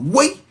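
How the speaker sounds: "Woy" squealed high; Patois exclamation. Shown as a demonstration. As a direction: shoot "Woy" up high, rhyming with boy. Catchphrase queried by chat.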